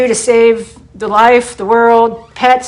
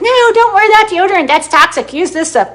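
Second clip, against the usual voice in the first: high, nagging mom voice, a bit louder; imitating mom; 8 semitones higher, 3 dB louder than usual. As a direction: mimic a fussy mother scolding. Mom's health warnings quoted.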